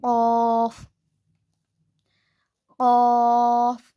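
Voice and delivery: क़ाफ़ heavy, q from the back of the tongue at the uvula; क़ाफ़: uvular q, full heavy a; Arabic qaf. Shown as a demonstration. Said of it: "ज़ुबान की जड़ को छोटी ज़बान के पीछे".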